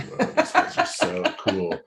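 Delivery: slow, awed whoa breaking into a laugh; gushing over the band with a laugh. Retold or imitated. Recalling a band at his first gig, laughing in awe. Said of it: "And I was just like".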